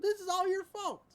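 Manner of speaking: high-pitched, hammed-up blaming; put-on indignant outburst; mock accusation. Acted blaming in retold scene.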